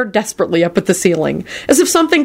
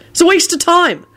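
high-pitched irritated retort; snapping; 12 semitones higher than usual. A narrator's reading. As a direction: snap it, pitched way up. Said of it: "George snapped".

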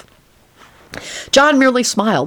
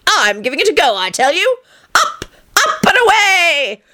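gleeful takeoff; 12 semitones higher than usual. A narrator's reading. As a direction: launch yourself with a laugh. John exuberant.

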